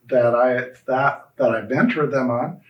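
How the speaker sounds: ordinary talk, nothing marked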